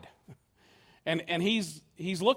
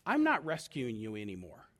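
low, grave voice of God; God's final refusal; 9 semitones lower, 4 dB quieter than usual. Acted. God tells His people the rescue is over, low and final. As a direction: drop low and draw a firm line. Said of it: "God's eventually gonna say".

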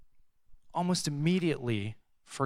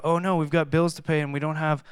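worried; 3 semitones higher, 6 dB louder than usual. Retold or imitated. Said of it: "I start worrying about".

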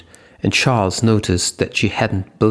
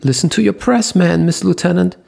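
much higher and louder, a squeal; 9 semitones higher, 4 dB louder, faster than usual. A narrator's reading. Leo pleading with Eva to listen, high squeal. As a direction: squeak it out in alarm. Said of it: "Leo squealed".

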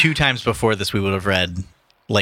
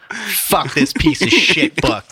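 slow, laughing mock outburst; mock frustrated reader; 7 semitones higher, 3 dB louder, much slower than usual. Friend mocks a reader who gives up on the book, slow and laughing. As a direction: play the fed-up reader throwing the book. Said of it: "That's an accurate reenactment".

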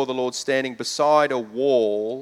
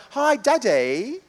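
put-on Peppa Pig accent; 9 semitones higher, slower than usual. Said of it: "she'd go".

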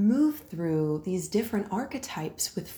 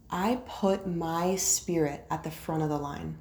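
usual speaking voice

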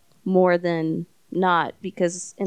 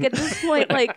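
laughing; 6 semitones higher than usual. Real, not acted. Laughing while telling.

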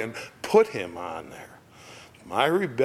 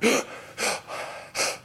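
sudden high breathy gasp for air; sharp gasp; 12 semitones higher, 5 dB quieter than usual. Retold or imitated. Rescued people gasping for air. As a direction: gasp like surfacing from water. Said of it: "we're gasping for air".

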